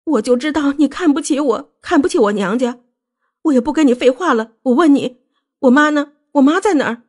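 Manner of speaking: high-pitched tearful accusation; upset wife, voice pitched high; crying. A narrator's reading. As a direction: plead and accuse through acted tears. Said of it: "穆小薇哭起来".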